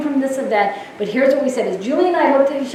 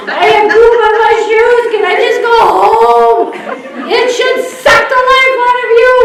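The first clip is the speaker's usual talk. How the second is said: hammed-up whiny complaint, high and loud; mock drained nurse; 6 semitones higher, 3 dB louder, slower than usual. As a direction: moan like a worn-out nurse, laughing. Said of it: "come out of Loretta's room going".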